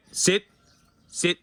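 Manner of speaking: stern repeated sit commands; firm dog command. Real, not acted. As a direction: make the dog obey.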